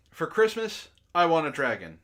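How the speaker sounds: normal talking voice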